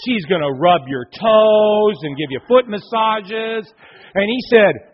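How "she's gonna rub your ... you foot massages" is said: slow and louder, stretching each promise out; slow, savoring promise. Acted. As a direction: draw out every perk like a sales pitch. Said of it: "He says".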